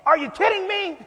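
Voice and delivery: mock disbelief. Others also exasperated.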